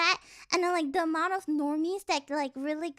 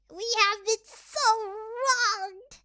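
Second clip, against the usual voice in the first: slow, hammed-up dramatic lament; mock outrage; 5 semitones higher, 3 dB louder, much slower than usual. Streamer hamming up weeb injustice, slow and dramatic. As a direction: wail it like a tragic hero. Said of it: "I'm like".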